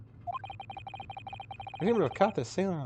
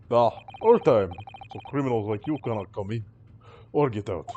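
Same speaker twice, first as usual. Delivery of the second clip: put-on Russian-accented guard; 7 semitones lower, 4 dB louder than usual. Sailor claims constant watch.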